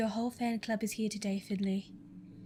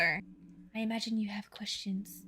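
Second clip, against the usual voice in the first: whisper; 8 dB quieter, slower than usual. Acted. Character speaks quietly.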